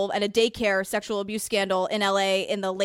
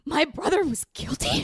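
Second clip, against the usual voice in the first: raised, disbelieving impression of the brother; Queen Howard impression; 6 semitones higher than usual. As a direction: do the brother, high and incredulous. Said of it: "can you do your Queen Howard impression".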